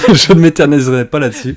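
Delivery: embarrassed laughter; laughing. Real, not acted. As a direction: giggle through the self-mockery. Laughing at own bad accent.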